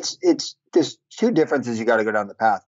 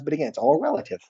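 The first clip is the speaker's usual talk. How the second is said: ordinary delivery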